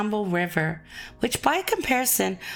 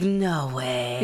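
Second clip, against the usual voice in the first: "No way" said low, in a deeper tone; lower tone; 8 semitones lower than usual. Shown as a demonstration. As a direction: drop the voice low for "No way". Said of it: "When you say it lower".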